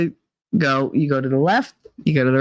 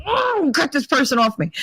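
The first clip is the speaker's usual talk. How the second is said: higher, exasperated get-off-me; high panicked plea; 9 semitones higher than usual. Retold or imitated. Woman smothered by a stranger, high exasperated plea. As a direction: squirm free of the hug. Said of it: "I'm just like".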